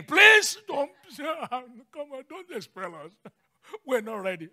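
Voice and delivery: high mock plea breaking into chuckles; begging demons. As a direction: whimper like comically pleading demons, laughing between phrases. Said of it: "See, demons begging".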